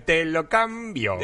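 slow, high, drawn-out announcement; TV host catchphrase. Retold or imitated. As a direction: stretch it like a game show host. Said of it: "de repente decían".

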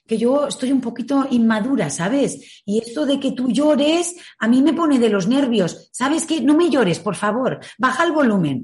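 mild mock exasperation hushing a baby; impatient mother voice. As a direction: lightly play a fed-up, immature mum. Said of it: "A un bebé no le puedes decir".

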